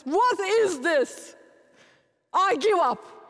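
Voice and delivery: slow, loud, fed-up outburst; exasperated husband. Retold or imitated. Husband fed up with daughter's messy room, loud exasperated outburst. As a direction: throw up your hands and drag out the question. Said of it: "My husband goes and says".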